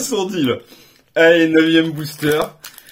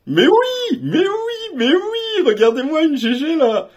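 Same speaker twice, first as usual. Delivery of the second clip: much higher squeals of pure joy; excited shriek; 11 semitones higher, slower than usual. Real, not acted. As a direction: shriek with delight at the pull. Big card pulled during opening.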